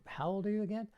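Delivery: high, playful fake confusion; mock puzzled. Shown as a demonstration. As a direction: play dumb for laughs.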